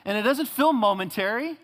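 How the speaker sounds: pained, high and loud pleading; a sufferer protesting to God in anguish; hurting cry. Acted. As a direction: cry out in pain at God, slowly. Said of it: "You say".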